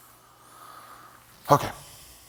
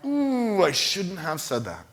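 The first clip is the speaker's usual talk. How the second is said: sheepish regret; 4 semitones higher, slower than usual. Retold or imitated. Quoting the moment of regret.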